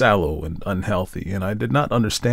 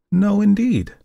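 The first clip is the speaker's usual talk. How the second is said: put-on higher, sure young woman; 12 semitones higher, 4 dB louder, slower than usual. A narrator's reading. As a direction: answer firmly as Tessie. Tessie's answer.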